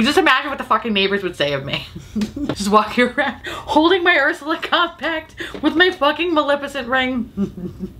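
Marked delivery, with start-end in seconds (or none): 2.5-7.9 laughing daydream. Pretend. Imagining herself.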